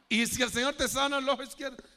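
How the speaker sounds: normal talking voice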